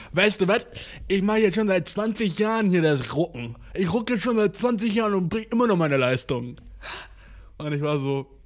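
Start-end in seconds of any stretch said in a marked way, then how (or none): 0.1-6.6 loud, higher, blustering boast; indignant gym guy. Retold or imitated. The gym guy boasting back, loud and higher. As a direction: puff up and brag back. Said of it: "Und er dann so".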